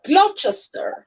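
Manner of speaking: slow, high "Gloucester" as GLOT-ches-ter; naive spelling pronunciation. Shown as a demonstration. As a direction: sound out "Gloucester" slowly with a ch. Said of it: "that's how you're gonna say it".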